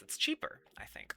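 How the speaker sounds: whispered aside. Real, not acted. Doubtful aside.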